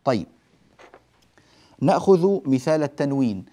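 normal talking voice

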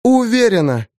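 lightly voiced woman, firm and quick; Eva's voice. A narrator's reading.

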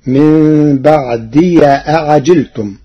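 بعدي ending in an open ya, baʕdiya. Shown as a demonstration. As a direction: end بعدي on ya with a fatha. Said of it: "بفتح ياء الإضافة". (بعدي بفتح الياء)

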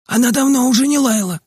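put-on high-pitched boy's call from a window; Makar calling out. A narrator's reading. Makar calls to the boys from the window, high calling voice. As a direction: call it out high, without real shouting volume. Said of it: "крикнул нам Макар из окна".

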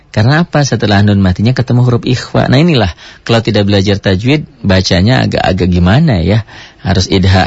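ordinary talk

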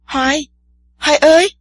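put-on a frightened girl calls her friend from the bank. A narrator's reading. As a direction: shout for him, scared he has vanished. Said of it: "Tiên Sa sợ hãi kêu lên".

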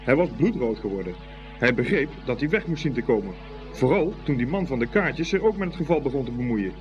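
ordinary delivery

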